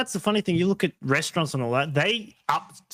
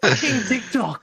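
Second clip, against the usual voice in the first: ranting; 9 semitones higher, 4 dB louder, slower than usual. Real, not acted. Ranting about TikTok users.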